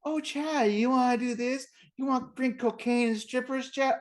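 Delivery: high, coaxing mock kid; power suggestion. Friend mimics kid tempting babysitter, high coaxing voice. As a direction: imitate someone sweetly planting an idea. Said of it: "give you that power suggestion".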